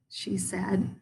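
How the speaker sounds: ordinary delivery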